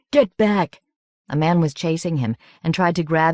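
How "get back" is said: loud, higher urgent yell; shouting. Acted. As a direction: yell at them to back off. Peter shouts in a struggle.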